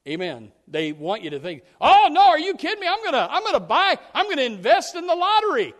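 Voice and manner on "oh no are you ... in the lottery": loud, high, scoffing bravado; cocky dismissal. Acted. Sinner brushing off counsel, loud cocky bravado. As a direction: wave off the warning with swagger.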